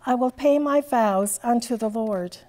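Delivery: ordinary delivery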